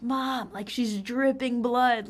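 dramatic retold panic; imitated freaking out. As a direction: mimic a frantic friend calling mom. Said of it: "him freaking out".

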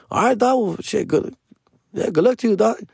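high, sing-song goodbye; mock polite goodbye. Retold goodbye again.

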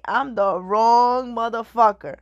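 slow, amused repeat; laughing. Real, not acted. Repeats the line, slower and laughing.

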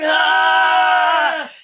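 loud, high pained scream; retold scream. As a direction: let out the victim's scream. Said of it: "And she's screaming".

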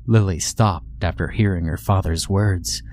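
ordinary delivery

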